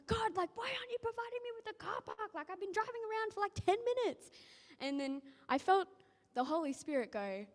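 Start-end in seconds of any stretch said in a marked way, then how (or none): 0.1-4.3 high, fast, whining at God; whiny complaint. Retold or imitated. Frustrated driver complaining to God after ten minutes, high fast whine. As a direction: moan impatiently like a fed-up driver. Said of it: "my attitude".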